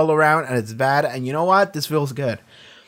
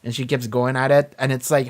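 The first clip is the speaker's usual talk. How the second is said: ordinary talk, nothing marked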